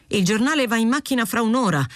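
flustered. A narrator's reading. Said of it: "trovò il proto tutto affannato".

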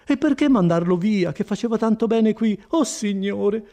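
high, loud pleading woman; anguished, high-pitched complaint; distressed Agnese. Acted. Voices Agnese in dialogue.

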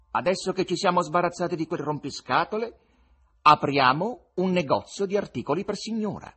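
a conjurer selling the show to the crowd. Acted.

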